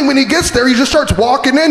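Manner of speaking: ordinary talk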